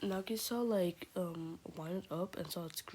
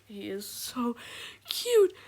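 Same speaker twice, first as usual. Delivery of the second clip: building to a high, loud squeal; gushing adoration; 4 semitones higher, 8 dB louder, slower than usual. Real, not acted. Fan gushing over beloved Toothless, squealing adoration. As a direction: squeal it out with fangirl delight.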